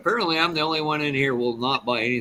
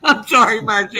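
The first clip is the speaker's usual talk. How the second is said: laughing apology; 7 semitones higher, 9 dB louder than usual. Real, not acted. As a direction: still laughing, much louder. Apologising after laughing fit.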